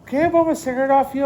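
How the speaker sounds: high mock woman's voice, asking for a smoke; imitating the lady. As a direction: squeak it out like the lady begging. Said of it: "She's like".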